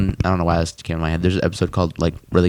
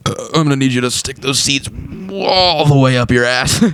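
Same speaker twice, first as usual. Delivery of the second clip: Rick voice; 3 semitones higher than usual. Retold or imitated. Retelling Rick's voice.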